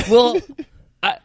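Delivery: giggling through the words; laughing. Real, not acted. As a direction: laugh while you answer, embarrassed. Laughing while retelling reply.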